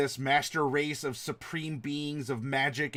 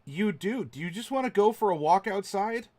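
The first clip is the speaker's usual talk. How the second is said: higher-pitched watchman voice; coaxing watchman; 5 semitones higher than usual. Acted. Watchman to a panicking guest, coaxing him outside. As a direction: gently suggest a way out.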